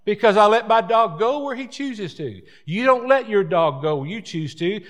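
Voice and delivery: hammed-up indignant defense of his dog; country dog owner protesting. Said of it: "And the country people says".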